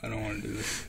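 whispering. Real, not acted. Dreading eating a bad bean.

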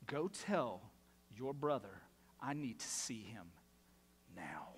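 put-on parent summoning a kid, measured and menacing; stern parent. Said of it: "when mom or dad would say those".

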